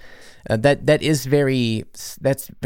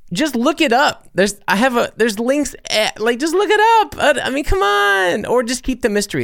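much higher, louder, mock exasperation; exasperated; 13 semitones higher, 3 dB louder than usual. Real, not acted. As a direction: throw up your hands at the obvious question. DM asks if he's American.

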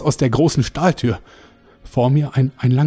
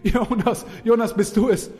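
frantic pleading; 8 semitones higher, 4 dB quieter than usual. Acted. Frantic warning to twin.

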